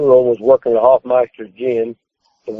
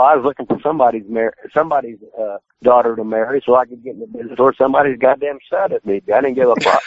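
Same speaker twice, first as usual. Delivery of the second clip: ordinary talk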